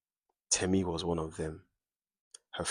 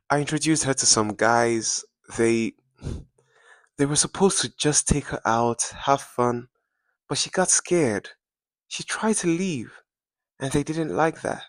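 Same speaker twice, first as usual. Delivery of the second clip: much higher and louder, panicky; frightened confession; 7 semitones higher, 6 dB louder than usual. Acted. Bella confessing to the mother what happened, frightened and high. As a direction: blurt it out as fear takes over.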